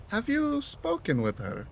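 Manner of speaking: gently formal tea host; polite character voice. Acted. Voices shopkeeper character Boro.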